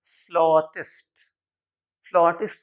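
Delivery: फ्लॉटिस्ट said "FLAW-tist"; correct pronunciation. Shown as a demonstration. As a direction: open "aw" in the first syllable of फ्लॉटिस्ट. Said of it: "English में कहते हैं फ्लॉटिस्ट". (फ्लॉटिस्ट में "फ्लॉ" का लंबा, खुला उच्चारण)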